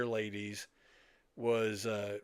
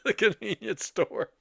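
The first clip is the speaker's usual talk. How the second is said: laughing; 9 semitones higher, 6 dB louder than usual. Real, not acted. Laughing while finishing the story.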